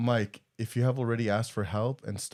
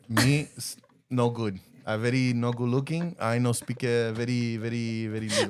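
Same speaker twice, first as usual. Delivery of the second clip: put-on halting broken-English accent with tapped r's; mock broken English. Joking about Bev's limited English, mock broken-English accent. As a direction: stumble through it in choppy, accented broken English. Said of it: "Bev, English".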